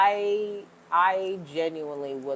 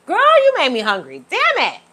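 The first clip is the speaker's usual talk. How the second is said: louder, higher, rising to a squeal; playful exclaim; 9 semitones higher, 9 dB louder than usual. Real, not acted. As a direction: complain to the viewer with mock outrage. Exclaiming to viewer she's hungry.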